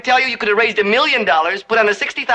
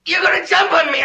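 higher, frightened cry; shouted fearful accusation; rising panic; 5 semitones higher than usual. Acted. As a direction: let the dread break into alarm.

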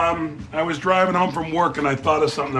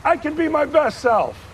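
high mocking echo; 12 semitones higher than usual. Echoing cashier's encouragement.

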